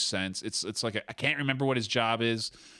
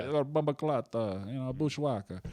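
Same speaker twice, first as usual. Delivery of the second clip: put-on Jamaican patois, a bit halting; Chet Hanks; 2 semitones higher than usual. Comic imitating an actor's patois, mock Jamaican accent. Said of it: "Chet Hanks, he does those".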